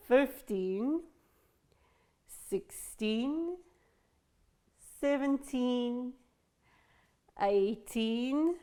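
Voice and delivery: put-on each number slow with teen clearly stressed. Shown as a demonstration. Teacher drilling the class on teen numbers, stressing teen. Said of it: "Be sure to pronounce the teen".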